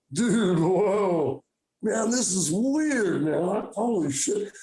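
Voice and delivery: slow, dazed stoner wonder; drawn-out, druggy amazement; acid voice. Acted. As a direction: imitate someone blown away on acid. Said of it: "I'm doing my acid voice now".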